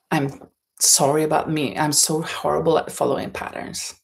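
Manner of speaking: normal talking voice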